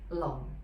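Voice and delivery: "Long" low and dropping; low falling tone. Shown as a demonstration. Teacher breaks down Bruce Lee's name, low falling "Long". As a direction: let "Long" fall to a low pitch. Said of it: "low falling".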